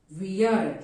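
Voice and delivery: we're modelled alone after a pause; we are joined into we're, said on its own; we're as one word. Shown as a demonstration. Teacher repeating the contraction for learners, we're as one word. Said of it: "We are का pronounce guys आप".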